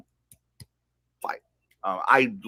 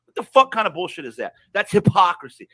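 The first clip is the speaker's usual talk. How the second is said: real anger; 8 semitones higher, 4 dB louder than usual. Real, not acted. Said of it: "and I'm mad".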